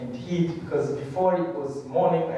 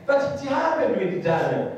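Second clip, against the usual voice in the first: raised, ringing sermon voice; preaching; 6 semitones higher than usual. Acted. Preacher rousing the congregation with call and response, fervent.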